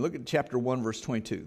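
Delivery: usual speaking voice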